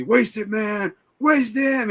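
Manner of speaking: high-pitched imitated shout; mock yell. Retelling a biker chick's order, high mock yell. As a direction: call it out high, egging on an attack.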